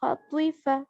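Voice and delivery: ordinary talk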